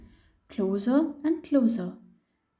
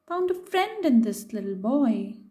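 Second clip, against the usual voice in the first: usual speaking voice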